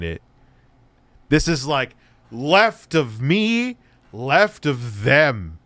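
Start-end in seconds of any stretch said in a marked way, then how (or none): 2.3-5.6 "me" stretched and lifted, "them" stretched and dropping; stress on ME and THEM. Real, not acted. Streamer contrasting who is further left, stress on "me" and "them". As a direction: draw out "left of me", then "left of them".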